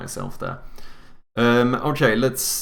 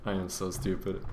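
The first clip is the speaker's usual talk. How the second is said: normal talking voice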